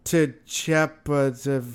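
slow, halting attempt at "To Chapa-", broken off; stumbling misreading. Outsiders asked to read Tchoupitoulas, halting "To Chapa-". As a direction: sound out "To Chapa-" haltingly and give up. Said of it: "I'd show 'em Tchoupitoulas".

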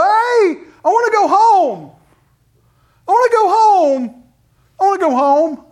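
put-on high, loud little-kid whine; childish whining voice; whiny child. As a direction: whine like an eight-year-old begging to go home.

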